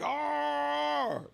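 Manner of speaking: high mock roar; mock growl. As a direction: growl like a raging drunk. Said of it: "They like".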